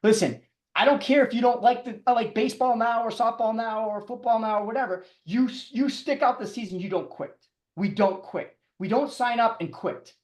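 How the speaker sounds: put-on stern coach voice telling the kids not to quit; coach lecturing kids. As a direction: lay down the rule like a youth coach. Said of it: "I raised them my whole life as their coach".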